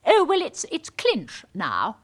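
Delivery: normal talking voice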